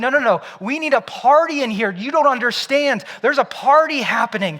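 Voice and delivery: a father who can't contain his joy; ecstatic father. Acted. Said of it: "The father's like".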